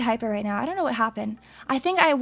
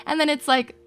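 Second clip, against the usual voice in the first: usual speaking voice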